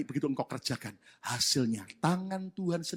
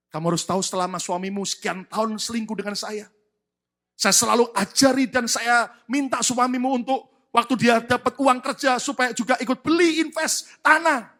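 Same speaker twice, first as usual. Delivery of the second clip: loud, raised voice, building in force; emphatic confession; 8 semitones higher, 12 dB louder than usual. Retold or imitated. Mistress explaining the land deeds to the wife, emphatic confession. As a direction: press each point home insistently.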